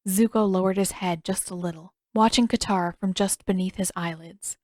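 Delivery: usual speaking voice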